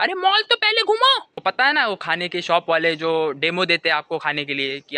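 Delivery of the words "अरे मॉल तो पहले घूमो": exasperated scolding. Acted. Scolding food-obsessed friends.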